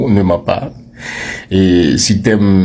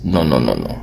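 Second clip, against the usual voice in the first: firm no; 7 semitones lower, 4 dB quieter, faster than usual. Real, not acted. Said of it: "rejecting the mistake".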